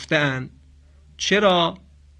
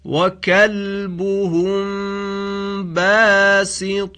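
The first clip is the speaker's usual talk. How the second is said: slow, higher chanted recitation of each word; Quran recitation; 4 semitones higher, much slower than usual. Shown as a demonstration. (تلاوت کشیده کلمات برای تکرار)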